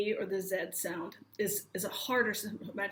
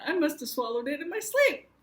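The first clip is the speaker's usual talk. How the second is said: higher, emphatic explanation; animated retold answer; 6 semitones higher, 5 dB louder than usual. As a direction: explain it emphatically, pitch climbing. Retold insistent answer on phone.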